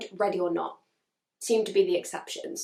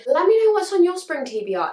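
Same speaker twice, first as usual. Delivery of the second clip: excited; 9 semitones higher, 6 dB louder than usual. Real, not acted. Sign-off call to viewers.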